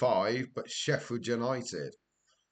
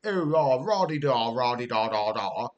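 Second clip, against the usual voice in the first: lightly mocking toff babble; a hint of plummy student chatter; posh student impression; 3 dB louder than usual. Mocking posh students in a pub, light toff babble. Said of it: "students in there who were posh as anything".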